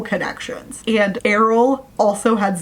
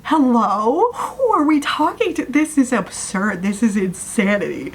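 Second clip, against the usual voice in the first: exasperated rant; 3 semitones higher than usual. Real, not acted. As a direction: rant at the book in disbelief. Ranting about the absurd plot twist.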